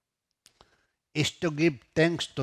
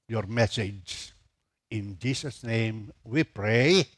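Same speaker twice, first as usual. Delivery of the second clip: prayer; 2 semitones lower than usual. Acted. Pastor leads congregation in prayer.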